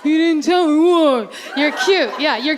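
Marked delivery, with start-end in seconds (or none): 0.0-1.3 slow dopey husband mumble; clueless husband, drawn out. Retold or imitated. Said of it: "same husband voice".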